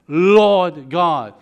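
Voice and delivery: "Lord" loud and drawn out before "God"; emphasis on Lord. Shown as a demonstration. Preacher points out the word to congregation, stressing "Lord" loudly. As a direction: land hard on "Lord", stretching it. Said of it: "that emphasis on the word".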